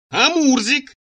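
high, sharp demanding cry; shouted. A narrator's reading. Furious Цира confronts him about Мурзик, shouting. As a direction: cry the name out at a high pitch. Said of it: "крикнула Цира".

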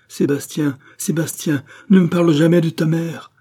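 rising from a pained plea to a loud, high shout; the father cries out, begging him to stop, building to a high shout; anguished outcry. A narrator's reading. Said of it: "s'écria le docteur".

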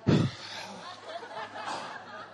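high airy theatrical sigh; hammed-up long suffering sigh; mock heavy sigh. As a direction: sigh like a put-upon saint. Mocking martyr after refusing drinks.

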